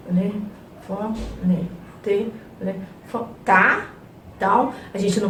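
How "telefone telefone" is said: very soft, slow TE-LE-FO-NE, syllable by syllable; as if mentally. Shown as a demonstration. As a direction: count out "Telefone" under your breath. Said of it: "a gente pode fazer mentalmente". ("Telefone" baixinho, sílaba por sílaba, como se fosse mentalmente)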